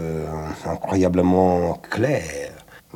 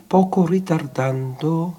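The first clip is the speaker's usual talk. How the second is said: higher, lightly imitated conductor's instruction; 10 semitones higher than usual. Director recalling how the conductor spoke to his orchestra, lightly imitated. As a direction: imitate a conductor, pitch lifted. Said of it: "il chante deux notes".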